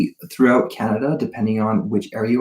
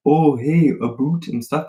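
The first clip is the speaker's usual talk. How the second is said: put-on Canadian accent; 5 semitones higher than usual. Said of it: "a lot of people assume that we're like".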